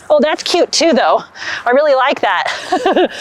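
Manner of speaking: ordinary talk, nothing marked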